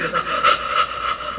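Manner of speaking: quick noisy gasps; short sharp breaths sucked in; panicked gasping. Shown as a demonstration. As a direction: gasp like a panicked diver. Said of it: "First, your breathing is like".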